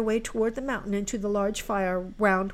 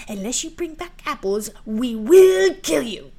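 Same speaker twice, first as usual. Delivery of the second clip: menacing threat; 4 semitones higher, 9 dB louder than usual. Acted. Helen threatens Marouckla over apples, menacing, building anger. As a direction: threaten her, leaning hard on the last words. Stepsister threatens to kill her.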